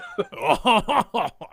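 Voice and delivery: hammed-up French-style laugh. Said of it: "can you French that laugh up a little bit?".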